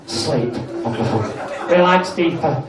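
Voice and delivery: low, soft sleep command; hypnotist's voice. Acted.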